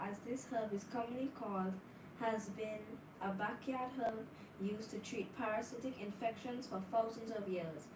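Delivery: ordinary delivery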